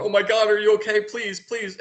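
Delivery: high, frightened pleading; almost crying nervous. Acted. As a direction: plead like a terrified maid. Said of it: "like, almost crying nervous".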